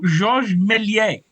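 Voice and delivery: Georges Méliès in a hammed-up French accent, drawn out slowly; playful French accent on Georges Méliès; butchered French accent. Shown as a demonstration. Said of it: "say his name in a, a slightly butchered French accent".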